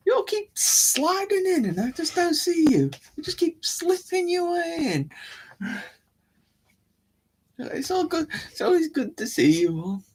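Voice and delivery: very high, silly voice; playful put-on greeting voice. Host to viewers who keep sliding in, playful high voice.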